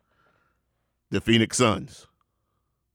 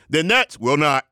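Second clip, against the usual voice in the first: normal talking voice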